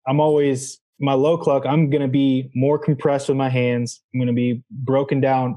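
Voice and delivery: ordinary talk, nothing marked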